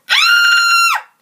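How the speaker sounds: piercing high loud scream. A narrator's reading. Goldilocks screaming as the chair cracks. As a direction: shriek at full pitch. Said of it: "Goldilocks screamed".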